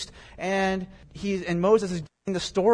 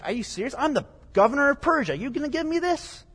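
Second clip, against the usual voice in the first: higher-pitched mock disbelief from an insulted ruler; incredulous governor; 9 semitones higher, faster than usual. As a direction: act the scoffing governor, voice climbing at the end. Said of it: "He's like".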